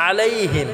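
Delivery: "আলাইহিম" with pressed first vowel and short ending; "আলাইহিম" with Arabic ain, unstretched him; throaty ain, short him. Shown as a demonstration. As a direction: squeeze the start of "আলাইহিম", keep him short. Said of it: "গলায় চাপ দিয়ে উচ্চারণ করব এবং হিমটা".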